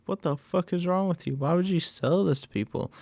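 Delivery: mock angry outburst. Bear yelling at the clerk, a mock angry high voice. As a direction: lightly voice Bear's outrage.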